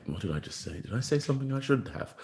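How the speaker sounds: rising, anxious inner voice, half laughing; mock panic. Player mocking his own panic after a podcast, anxious. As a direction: play the panicking player replaying his words.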